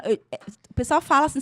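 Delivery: normal talking voice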